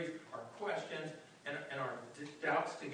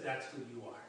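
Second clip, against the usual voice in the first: softer, lower, a quiet accusing voice; hushed inner voice; 8 semitones lower, 6 dB quieter than usual. Retold or imitated. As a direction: murmur it like a voice in your head. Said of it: "there's that voice that says".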